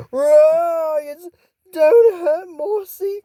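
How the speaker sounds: high, slow, crying plea; frightened swamp creature pleads with the girls, high and crying; scared monster cry. Acted. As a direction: beg them not to hurt you, in tears. Mossy frightened by roars.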